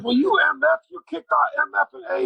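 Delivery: imitating a coach's affectionate swearing; friendly cursing. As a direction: curse him out like it's a compliment. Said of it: "cursing me, but in kind of a complimentary way".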